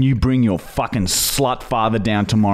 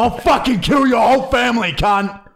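mock furious shout; 12 semitones higher than usual. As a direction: scream the threat over the top. Prank caller yelling threat.

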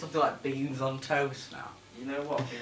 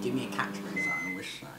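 low, soft mimicry; mimicking a friend; 4 semitones lower, 8 dB quieter than usual. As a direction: echo the mate's question lower and softer. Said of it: "I can't do impressions of you".

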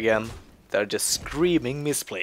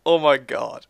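excited laughter; 5 semitones higher, 5 dB louder than usual. Real, not acted. Celebrating a comeback.

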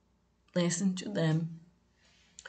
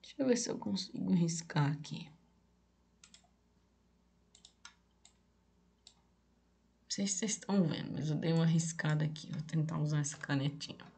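muttered aside; 6 dB quieter, much faster than usual. Real, not acted. Aside while drawing on screen.

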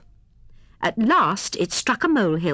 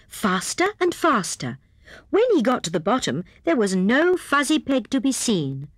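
ordinary delivery, nothing marked

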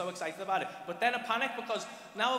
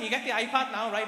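usual speaking voice

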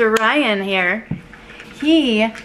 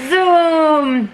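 car zoom sound; 7 semitones higher, 5 dB louder than usual. Pretend. Showing off toy car.

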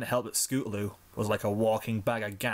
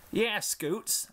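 high filly voice; 9 semitones higher than usual. Acted. Applebloom voiced.

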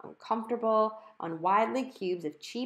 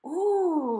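high breathy ooh of amazement; gasp of wonder; 11 semitones higher, 7 dB louder than usual. A narrator's reading. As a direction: gasp at the glittering pieces. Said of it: "Violet gasped".

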